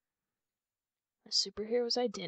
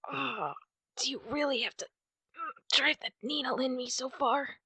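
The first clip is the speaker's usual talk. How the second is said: higher and louder, wincing through the words; pained complaint; 5 semitones higher, 6 dB louder than usual. Acted. Hero begging the listener stitching the wound, wincing in pain. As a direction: protest through the sting of the needle.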